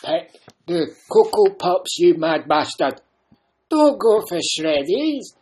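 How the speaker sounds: put-on bossy old Scotsman urging you on; growling Scottish inner voice; old Scottish man. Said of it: "it's an old Scottish man".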